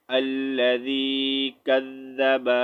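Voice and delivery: very slow, level recitation with held vowels; measured Quran recitation. Shown as a demonstration. Teacher joining spelled letters for students, slow recitation. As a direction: recite slowly on one note, stretching long vowels.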